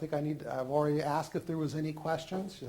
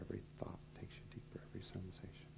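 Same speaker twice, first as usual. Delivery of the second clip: whispered; 8 semitones lower, 16 dB quieter, faster than usual. Acted. Hypnotist deepening trance.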